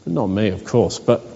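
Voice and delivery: dropped low, tongue-in-cheek; wry aside. Real, not acted. Pastor teasing the congregation about himself, low and wry. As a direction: throw it away with a wink.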